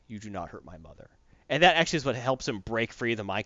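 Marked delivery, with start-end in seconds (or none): 0.1-1.1 lower, much softer, each word spaced; a quiet threat through the teeth; low hushed warning; low quiet menace. Retold or imitated. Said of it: "He's like".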